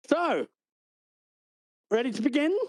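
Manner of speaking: a hint of a bright host; chirpy clerk. The clerk urges the recruits to start, chirpy.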